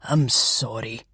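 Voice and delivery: low, slow and sincere; sincere apology. Acted. Detective to the grieving flatmates, low and sincere. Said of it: "sounding sincere enough".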